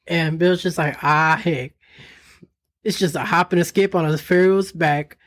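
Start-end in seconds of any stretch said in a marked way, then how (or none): 1.0-5.2 a hint of a folksy old timer; folksy country voice. Voicing folksy Bill.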